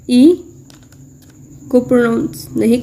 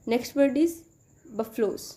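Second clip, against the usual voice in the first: ordinary delivery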